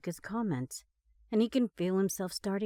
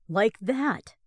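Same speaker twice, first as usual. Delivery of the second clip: a short, louder dry quip; 2 semitones higher, 7 dB louder than usual. Acted. Driver answering without looking, dry quip. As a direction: toss it off flippantly. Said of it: "Ross quips".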